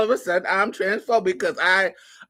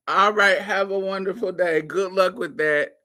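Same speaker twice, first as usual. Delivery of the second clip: ordinary talk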